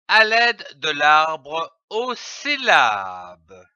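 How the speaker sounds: ordinary talk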